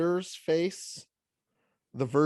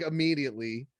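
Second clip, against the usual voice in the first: ordinary delivery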